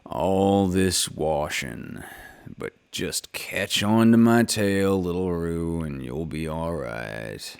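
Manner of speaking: low, slow, fading grumble to himself; quiet Eeyore grumble. A narrator's reading. Eeyore grumbles quietly to himself, offering Roo his tail, low and slow. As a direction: mutter it into your chest. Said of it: "grumbling quietly to himself".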